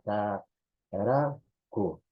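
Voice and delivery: Ka-ra-ku stretched slowly, syllable by syllable; slow classroom sounding out; sounded out. Shown as a demonstration. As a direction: draw out Ka-ra-ku slowly. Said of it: "Solemos escuchar".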